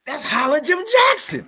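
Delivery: put-on high-pitched kid-like thrill; excited little kid. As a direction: squeal it like a starstruck child. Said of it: "I'm a little kid, man. I'm like".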